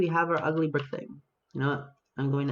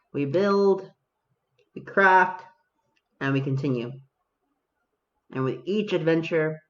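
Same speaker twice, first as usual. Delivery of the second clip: mock epic narration; 4 dB louder than usual. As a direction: announce it like a solemn game trailer. Epic mock voice-over on gaming.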